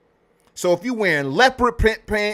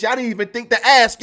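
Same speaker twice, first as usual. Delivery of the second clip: usual speaking voice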